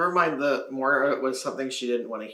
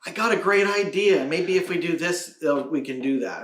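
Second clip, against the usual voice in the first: louder, higher eager pitch; persistent daughter; 7 semitones higher, 9 dB louder than usual. Retold or imitated. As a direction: sell a new idea hard. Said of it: "then it's like".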